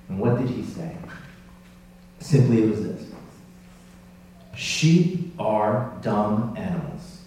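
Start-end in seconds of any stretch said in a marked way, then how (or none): 4.5-7.2 slow, weighty delivery of a quote; deliberate quotation. Retold or imitated. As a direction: let each word land slowly. Delivers the remembered statement solemnly.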